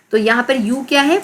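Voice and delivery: ordinary delivery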